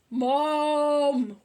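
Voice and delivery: loud wailing call for mum. A narrator's reading. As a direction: wail for mum at full volume. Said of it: "wailed Peter".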